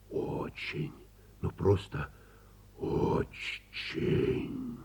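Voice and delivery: soft whispered очень; whispered wonder. Acted. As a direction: breathe out очень in awe. Wizard's whispered climax.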